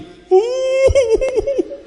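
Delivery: high, loud mock wail; long hammed-up boo-hoo; imitated crying. Speaker mimicking father-in-law moved by a kiss, imitated crying. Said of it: "terus dia menangis".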